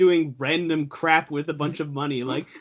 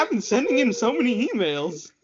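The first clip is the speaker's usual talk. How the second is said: higher, whiny fan complaint; mock fan voice; 7 semitones higher than usual. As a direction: play a slighted fan. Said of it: "'Cause they were like".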